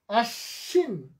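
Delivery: আশ্বিন said slowly, stopping on the n: ashin; slow model আশ্বিন. Shown as a demonstration. Teacher models আশ্বিন for online class, slow. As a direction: draw out আশ্বিন and clip the end. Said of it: "আমি তো থেমে গেছি".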